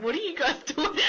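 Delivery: lightly imitated puzzled onlooker; imagined baffled onlookers. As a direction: voice the bystanders through laughter. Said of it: "They're probably like".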